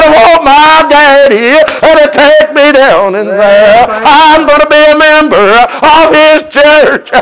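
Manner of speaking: put-on a boy asking for his daddy, slowly; young son's voice.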